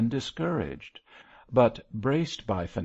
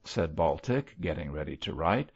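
usual speaking voice

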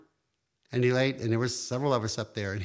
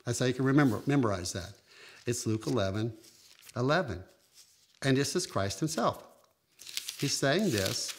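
normal talking voice

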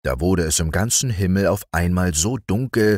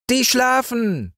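very high, proud little cat voice; cat calling down. Acted. Little cat calling down to the boy, high proud voice. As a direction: show off like a small cat. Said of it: "rief die kleine Katze herunter".

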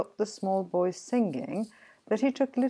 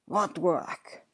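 lightly voiced goblin scorn; challenging goblin. A narrator's reading. Goblin queries the sexton's excuse, challenging. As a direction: throw the word back at him.

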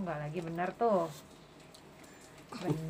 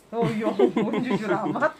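laughing; 12 dB louder than usual. Real, not acted. Child's blunt answer amuses her.

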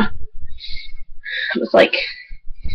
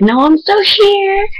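excited; 13 semitones higher, slower than usual. Retold or imitated. Laughing at being asked if passed out.

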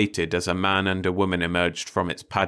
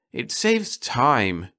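slow, deliberate excuse; defensive; 6 semitones higher, 3 dB louder, much slower than usual. A narrator's reading. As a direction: spell out "It saves time" slowly and firmly. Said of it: "Grace said".

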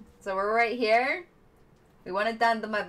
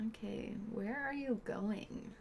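whisper; 13 dB quieter than usual. Real, not acted. Whispers question at game character.

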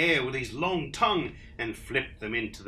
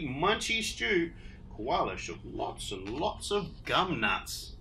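plain reading voice

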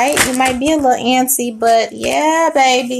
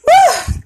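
high whew; 5 dB louder than usual. Real, not acted. Stunned by the tarot card.

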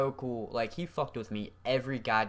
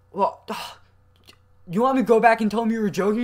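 exasperated; 11 semitones higher, 11 dB louder, much faster than usual. Retold or imitated. Kid exasperated at teacher.

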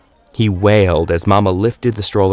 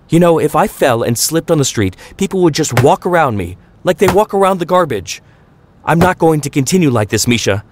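climbing higher and higher, fed-up tirade; raging rant; 8 semitones higher than usual. A narrator's reading. Mama venting to Papa at dinner prep, high climbing angry rant. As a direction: rant like every word is a knife whack. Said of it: "Mama raged at Papa".

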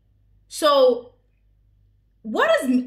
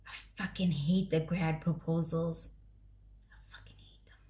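fierce whisper; 7 semitones lower, 11 dB quieter than usual. Real, not acted. Vlogger hissing her hatred of grad proposals, whispered. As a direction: hiss it low, dropping to a breath.

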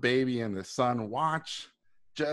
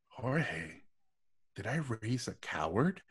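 softer, quietly disappointed question; father quietly asking his son if he raised a coward; 9 dB quieter than usual. Retold or imitated. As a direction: let the disappointment sink in, hushed.

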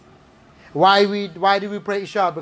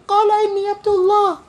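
put-on very high-pitched, quick baby voice reciting; 13 semitones higher, 3 dB louder, much faster than usual. Preacher wondering how the newborn sounded, mock baby voice. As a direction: pipe it up like a tiny baby reciting Arabic. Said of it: "say it in the baby voice".

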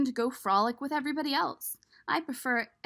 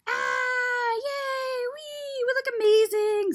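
much higher, squealy cheering; squealing eggs; 14 semitones higher, 7 dB louder, much slower than usual. Acted. The other eggs cheering at their festive looks, high and squealy. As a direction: squeal with delight like giddy little eggs.

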